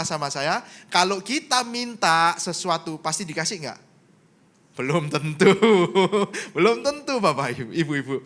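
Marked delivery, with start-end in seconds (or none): 4.7-8.2 amused answer breaking into laughter; laughing. Real, not acted. Preacher answering his own question to the congregation, laughing. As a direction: say it through a real laugh.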